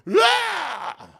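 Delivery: sudden high yelled "Ah!" like an excited billionaire; mock stage shout. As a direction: burst out with a hyped yell. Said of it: "get on stage and go".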